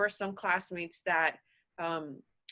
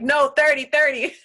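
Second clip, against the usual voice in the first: mock firm insistence; 5 semitones higher, 8 dB louder than usual. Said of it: "but I'm like".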